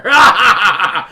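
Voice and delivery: loud, high, breaking into laughter; laughing echo. Real, not acted. Game master echoes a player's joke, laughing loudly. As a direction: burst out with the word and laugh.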